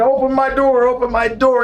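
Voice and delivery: high-pitched, urgent pleading; mimicked frantic demand. Locked-out wrestler to the maid, frantic. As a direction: imitate a man desperate to get in.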